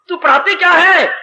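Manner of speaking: acted parental outburst; quarrelling mother. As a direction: demand an answer from the teacher, mock-angry. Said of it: "मैं तेरे साथ लड़ने आई हूं".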